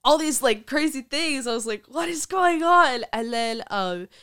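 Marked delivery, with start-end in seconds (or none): 1.9-3.0 much higher, louder, slow disbelief; amazed what. Retold or imitated. Amazed at speaking on stages, incredulous cry. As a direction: marvel at it all.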